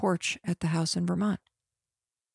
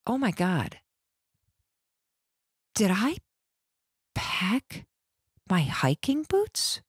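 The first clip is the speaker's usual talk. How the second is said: panicked thought; 4 dB quieter, slower than usual. Retold or imitated. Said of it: "this wave of panic".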